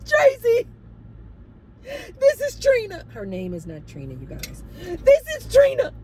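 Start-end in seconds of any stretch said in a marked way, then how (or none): 0.1-3.0 gasping, very high, loud mock sobbing; frantic crying imitation. Resident calling her property manager in tears, frantic crying. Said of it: "a woman crying frantic like this". 4.8-5.9 gasping, very high, loud mock sobbing; frantic crying imitation. Resident calling her property manager in tears, frantic crying. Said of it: "a woman crying frantic like this".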